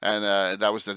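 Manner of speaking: ordinary talk, nothing marked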